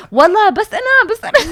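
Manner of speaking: loud, very high retold insistence; high protest. Crash victim swearing to police she was alone, high protest.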